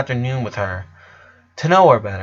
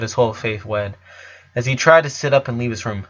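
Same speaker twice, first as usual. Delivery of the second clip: ordinary delivery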